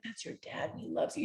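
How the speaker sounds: whispered, gentle reassurance to her son; breathy soft mediator voice. Retold or imitated. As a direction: hush it like soothing a child. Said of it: "I'd talk to Max and I'd be like".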